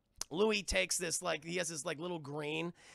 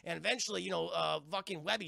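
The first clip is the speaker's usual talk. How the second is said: ordinary delivery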